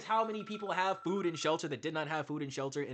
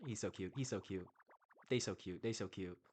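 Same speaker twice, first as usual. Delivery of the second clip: much softer, doting; soft cooing; 11 semitones lower, 14 dB quieter than usual. Real, not acted. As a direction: coo over them like pets. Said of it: "cooing over the characters".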